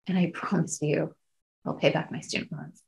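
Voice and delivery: real emotion, voice dropping very soft; hushed, emotional and trailing away; getting emotional. Real, not acted. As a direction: promise it softly, holding back feeling. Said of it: "I'm getting emotional".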